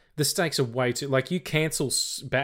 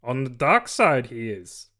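ordinary talk

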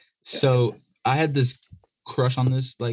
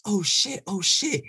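mock shocked classmates; 8 semitones higher than usual. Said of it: "everyone's just like".

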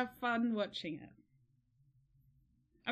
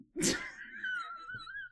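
high squealing laugh; delirious squeal laugh; 4 dB quieter than usual. Real, not acted. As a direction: let out a giddy shriek. Giddy delirious outburst.

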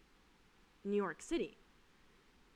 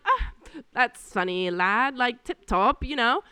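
slow mock British accent, louder; 3 semitones higher, 5 dB louder, slower than usual. Server mocking the English guests in British accent. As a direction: put on a jokey British accent.

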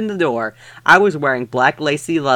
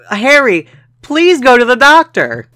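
pleading; 8 semitones higher, 5 dB louder than usual. Acted. Pleading character line read aloud.

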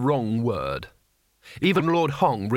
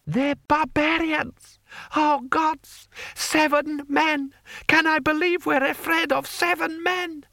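outraged rant. Acted. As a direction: rail at the absurdity. Lord Hong ranting.

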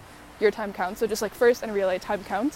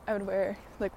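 usual speaking voice

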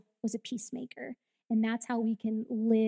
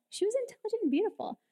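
high and fast; 10 semitones higher, 4 dB quieter, much faster than usual. Shown as a demonstration. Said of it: "let me make sure I say it right".

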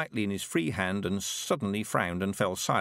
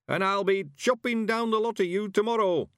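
put-on high, loud, gleeful old man crowing; old man's triumphant holler; triumphant shout; 12 semitones higher, 4 dB louder than usual. A narrator's reading. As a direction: crow it at the weeds, triumphant. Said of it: "he shouted triumphantly".

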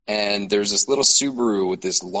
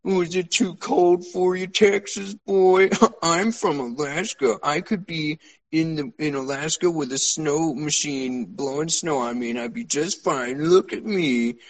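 put-on higher, smug teasing old man; lightly voiced smug polar bear of a man; smug stranger; 5 semitones higher than usual. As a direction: needle the Texan boy, higher and smug. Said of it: "and he says".

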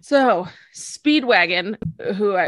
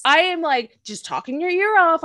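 ordinary talk, nothing marked

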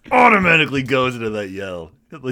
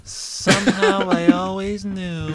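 dreamy lovestruck voice; 10 semitones higher, 4 dB louder, slower than usual. Acted. Voicing MJ's dreamy line.

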